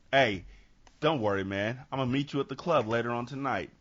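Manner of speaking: low, soft, laid-back hustler; Sugar Man's deep voice. Acted. Robinson reassures a man about the club tonight, deep voice. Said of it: "Like his voice got deeper".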